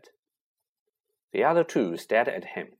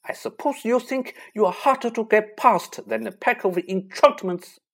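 much higher and louder, furious scolding; stormy telling-off; 11 semitones higher, 5 dB louder than usual. A narrator's reading. Teacher storming at Harry and Ron: furious scolding. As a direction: storm at them like a fierce teacher. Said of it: "she stormed".

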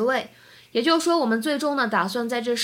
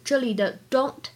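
ordinary talk

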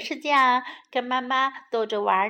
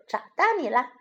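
plain reading voice

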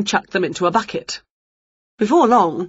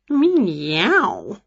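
proud meow. Acted. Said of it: "said Wilbur proudly".

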